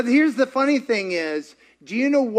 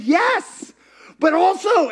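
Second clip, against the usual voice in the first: excited yes; 11 semitones higher, 6 dB louder, slower than usual. Retold or imitated. Cashier answering, amazed.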